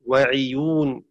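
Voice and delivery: وعيون with an i replacing u after the ayn; careful "ʕiyūn" in وعيون; kasra on ayn. Shown as a demonstration. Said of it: "بكسر ضم العين".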